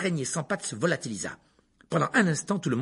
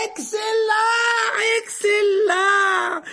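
very high, drawn-out cheer; exclaimed praise; 7 dB louder, much slower than usual. A narrator's reading. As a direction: cheer the pupil over applause. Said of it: "s'exclama le professeur Lupin". (exclamation de joie, aiguë et étirée)

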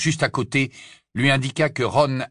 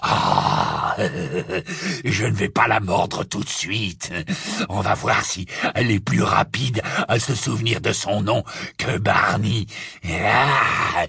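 gloating werewolf; slower than usual. Acted. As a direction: toy with her, chuckling. Werewolf gloats over girl.

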